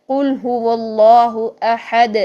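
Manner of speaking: slow, drawn-out Quran recitation. Shown as a demonstration. Teacher reciting Surah Ikhlas as an example. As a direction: recite it measured and long. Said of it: "احد کر کے بند نہیں".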